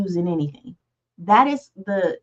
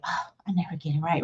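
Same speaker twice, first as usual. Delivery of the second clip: low, quick defeated mumble, much softer; deflated self-talk; 4 semitones lower, 9 dB quieter, faster than usual. Retold or imitated. Imitating someone talking down to themselves, low and fast. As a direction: sigh it out like you gave up long ago. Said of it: "You said it in the style".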